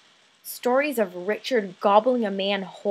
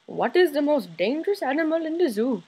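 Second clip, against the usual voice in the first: father's voice, higher and swooping in pitch; imitating father; 5 semitones higher than usual. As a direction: put on your dad's voice for his pet question. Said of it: "of my father asking me".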